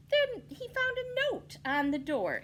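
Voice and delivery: ordinary delivery, nothing marked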